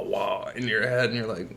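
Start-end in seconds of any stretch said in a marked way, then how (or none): none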